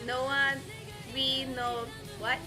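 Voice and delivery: normal talking voice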